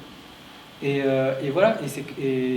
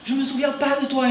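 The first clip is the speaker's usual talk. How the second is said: much higher, mock yelled refusal; imitated shout; 9 semitones higher than usual. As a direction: yell it back, high and strained. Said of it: "il se met à me hurler".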